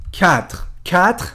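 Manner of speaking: کتر said slowly, twice, French quatre with silent final e. Shown as a demonstration. Teacher sounding out French quatre for students. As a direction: drag out کتر syllable by syllable. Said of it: "گفتیم این که".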